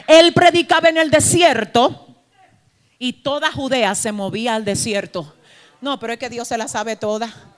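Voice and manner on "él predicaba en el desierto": normal talking voice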